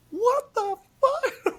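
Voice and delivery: very high, drawn-out squeal of disbelief; mock horrified shock. Imitating wife being shown the video, high aghast squeal. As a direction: stretch it out slowly, squeaking with laughing horror.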